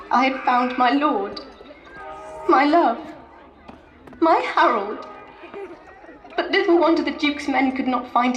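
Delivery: tragic heroine sorrow. Acted. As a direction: cry out over the body. Edith finds Harold's body.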